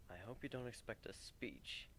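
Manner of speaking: hushed, soft whisper leaning in; a quiet aside whispered to a friend. A narrator's reading. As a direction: whisper it close so the crowd can't hear. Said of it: "he whispers".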